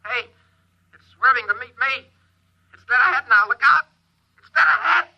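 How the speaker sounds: building from tense to a high, loud panic; the object is about to hit you; rising alarm. Acted. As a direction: pilot reliving the chase, climbing into panic. Pilot relives the collision course.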